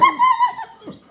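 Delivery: shrill delighted laugh; high squealing laugh. Real, not acted. Laugh after praise.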